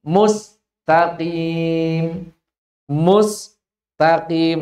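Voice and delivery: "mustaqim" said very slowly, split into pieces; broken recitation. Shown as a demonstration. Teacher showing how a broken reading sounds. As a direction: stretch "mustaqim" very slowly with a gap inside. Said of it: "Kalau bacanya".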